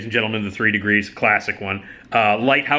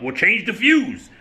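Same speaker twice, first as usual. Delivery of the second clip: higher, slow grouchy retort as Fred; grumpy Fred; 10 semitones higher, slower than usual. Retold or imitated. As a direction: snap back like an annoyed old man. Fred answering Lamont.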